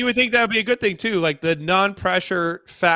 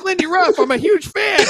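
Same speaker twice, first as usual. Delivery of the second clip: eager fanboy gush; 13 semitones higher than usual. Pretend. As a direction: squeal it like an excited fan meeting a hero. Acting out eager fan greeting.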